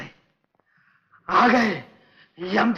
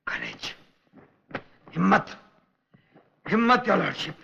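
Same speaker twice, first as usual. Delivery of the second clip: ordinary talk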